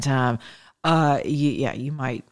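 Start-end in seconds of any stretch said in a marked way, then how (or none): 1.5-2.3 muttered, quick jab; teasing aside. Acted. Teasing viewers who voted twice for Obama, a mumbled joke. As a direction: drop the voice for a sly jab. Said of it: "I'm kidding".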